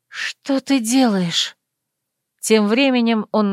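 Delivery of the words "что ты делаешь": slow question on an outgoing breath; asked on exhale. A narrator's reading. Entranced heroine to her lover with a ring, asking on exhale. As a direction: draw the question out as you exhale. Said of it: "спросила на выдохе".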